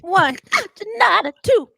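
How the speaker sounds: counting push-ups in a squeaky star voice. Pretend.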